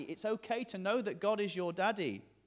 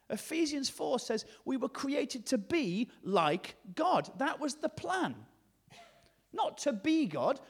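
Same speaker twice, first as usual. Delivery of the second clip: higher-pitched, emphatic sermon delivery; impassioned preaching; 8 semitones higher, 6 dB louder, slower than usual. Acted. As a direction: proclaim it to the congregation in deliberate phrases. Preaches with rising force.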